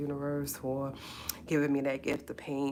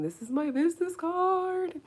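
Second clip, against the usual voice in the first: excited squeal; 3 dB louder than usual. Real, not acted. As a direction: squeal it proudly, stretching the last word high. Showing off her new business card.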